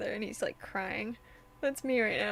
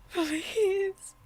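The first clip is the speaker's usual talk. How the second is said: laughing plea; 7 semitones higher, 4 dB louder than usual. Real, not acted. Overwhelmed by game scene.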